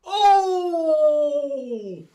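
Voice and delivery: high loud excited cry; a loud yelp at the near miss; real cry of excitement. Real, not acted.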